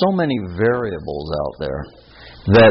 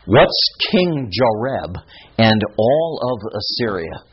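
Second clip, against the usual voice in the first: ordinary talk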